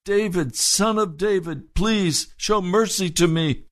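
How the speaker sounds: high, pleading cry repeated. Retold or imitated. Blind man crying again to Jesus, high and pleading. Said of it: "He cried even louder".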